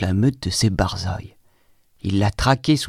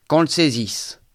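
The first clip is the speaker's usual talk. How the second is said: raised, high-pitched order; general's command; 6 semitones higher than usual. Acted. General orders servants to seize the boy, commanding.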